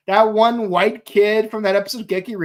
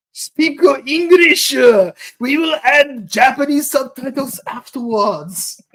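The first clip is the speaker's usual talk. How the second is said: slow, higher, louder mock Japanese accent; 4 semitones higher, 3 dB louder than usual. Director telling actors to speak English, mock Japanese accent. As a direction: order it in a put-on Japanese accent.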